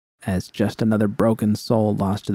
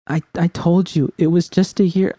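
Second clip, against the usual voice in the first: V pitched higher, pleading and louder; V, upset and defensive; 8 semitones higher, 3 dB louder than usual. A narrator's reading. V to Judy, cornered and pleading, high strained voice.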